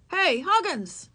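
high, slow calling yell. A narrator's reading. Boy summoning Henry Huggins, yelling. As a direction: yell it out to a friend. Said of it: "yelled the boy".

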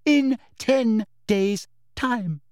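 high, over-enunciated condescension; patronising inspector, word by word; loud, slow voice. A narrator's reading. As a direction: spell it out as if to someone dim. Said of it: "speaking once more in that loud, slow voice".

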